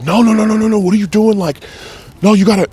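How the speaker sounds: very high, loud reenacted pleading; distraught friend. Best friend begging him to stop, distraught. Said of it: "I had never heard him cry".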